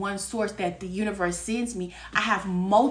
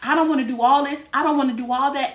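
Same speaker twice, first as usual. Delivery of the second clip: louder, insistent declaration; retold firm demand; 4 semitones higher, 6 dB louder than usual. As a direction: lay down exactly what you want. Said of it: "I'm like".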